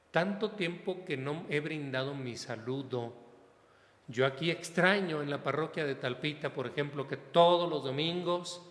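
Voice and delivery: usual speaking voice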